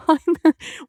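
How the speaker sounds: high, bright burst of laughter; delighted laugh. Real, not acted. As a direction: laugh with relief and joy. Joyful laugh.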